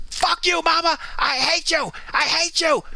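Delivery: high, whiny mock screaming brat; bratty kid tantrum. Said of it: "the little kid is like".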